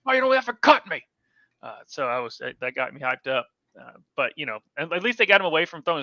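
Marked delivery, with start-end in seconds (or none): 0.0-1.0 high, louder mock quarterback voice; imitating Jake Browning. Co-host retelling a player's sideline quote, in a high put-on voice. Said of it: "had one of the best quotes on the sidelines".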